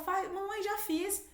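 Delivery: put-on high, sweet little child voice. Child replying to mom, high sweet child voice. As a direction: answer like an eager little kid.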